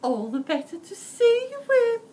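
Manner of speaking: high, loud put-on granny voice; the wolf faking Grandma's voice; wolf in Grandma disguise. A narrator's reading. Wolf answers Red in a high false Grandma voice. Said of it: "the wolf replied".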